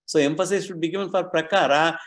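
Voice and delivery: ordinary delivery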